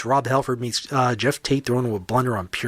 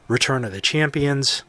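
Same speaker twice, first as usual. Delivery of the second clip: normal talking voice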